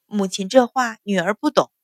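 ordinary delivery, nothing marked

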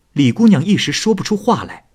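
plain reading voice

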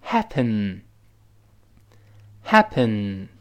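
"happen" very slow, each syllable stretched; model reading. Shown as a demonstration. Teacher reads the word for learners, very slowly. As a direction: draw out "happen" with long gaps for repeating. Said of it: "我们读一下这词".

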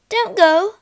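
high, loud plea; crying out. A narrator's reading. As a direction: call out high and loud to stop her. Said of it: "cried her mother".

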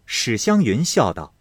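usual speaking voice

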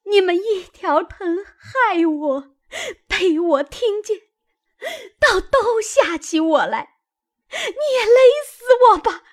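high, tearful wail building to a scream; crying out. A narrator's reading. Wife butting into her husband's arms, wailing. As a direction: shriek in grievance, peaking loud and quick. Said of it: "叫道".

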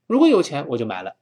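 usual speaking voice

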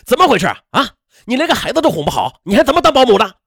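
loud, high-pitched furious rebuke; angry shout. A narrator's reading. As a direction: shout her down as incompetent. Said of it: "冲到楼上大声的喊道". (大声怒喊)

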